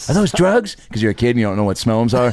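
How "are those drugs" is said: put-on high-pitched startled kid question; alarmed kid. Sixth grader asking his friend about smellums, high and alarmed. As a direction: squeak it out like a scared sixth grader.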